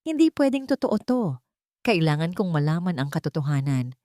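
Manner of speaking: plain reading voice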